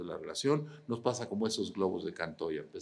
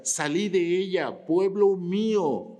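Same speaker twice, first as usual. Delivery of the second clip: higher, louder, slow solemn call; voice from heaven; 11 semitones higher, 10 dB louder, slower than usual. A narrator's reading. Preacher reading the voice from heaven, slow and loud. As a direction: proclaim it slowly like a voice from the sky. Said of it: "otra voz del cielo que decía".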